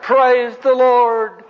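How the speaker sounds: high, long drawn-out holler; retold holler. Hippie reading the Bible on the curb, hollering with joy. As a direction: holler it out, stretching the words way up high. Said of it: "And he hollered".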